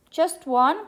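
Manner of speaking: little bear exclaims in surprise, lightly acted; crying out. A narrator's reading. Little bear to mama over the pie, mildly crying out. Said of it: "cried Little Brown Bear".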